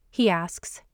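ordinary delivery

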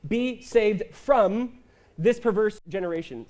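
preacher quoting scripture, slow and weighty; quoting Peter. Retold or imitated. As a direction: stretch out be saved from. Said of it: "He says".

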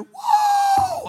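high, loud "whoo" whoop; imitated old-fashioned revival holler; old lady's church shout. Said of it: "I mean, she was shouting".

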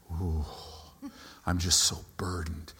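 low breathy whisper, put-on heaviness; mock burdened sigh. Pastor mocking a gloomy pray-er, whispered and low. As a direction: sigh it out as if crushed. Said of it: "that you're like".

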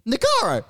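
Negaro said nuh-GAR-oh in a high mock voice. Imitating friend saying the name wrong, high and mocking. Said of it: "And he goes".

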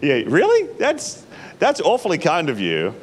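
giggling, half-stunned thanks; amused, incredulous gratitude; laughing disbelief. Retold or imitated. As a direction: laugh through the words in disbelief. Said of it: "I'm gonna be like".

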